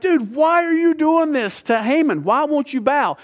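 high-pitched, exasperated demand for an explanation; baffled onlookers challenging a stubborn man; incredulous questioning. Acted. Crowd confronts Mordecai in story.

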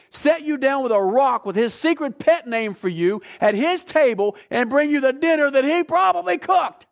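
loud, high, impassioned build to a peak; full-force preaching. Real, not acted. Preacher tells congregation how much he loves them, impassioned preaching. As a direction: pour it out, hammering the key words.

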